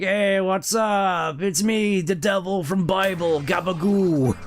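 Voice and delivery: slow, high, casual demon voice; hammy chill devil saying hi. Retold or imitated.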